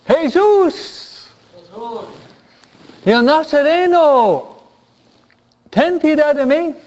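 high-pitched cry for mercy; acted shouted plea. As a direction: call out to someone passing far off. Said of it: "él inmediatamente grita".

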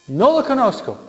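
Peter's heated, insistent denial; cursing denial. Acted. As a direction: deny it hotly, half-cursing. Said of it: "Empieza a maldecir".

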